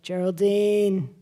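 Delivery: slow, cranky East Coast drawl; cranky New York accent. Retold or imitated. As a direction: growl it out like a grumpy New York dad. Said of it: "crankier and kinda monotone".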